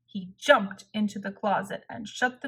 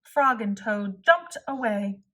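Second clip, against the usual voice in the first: plain reading voice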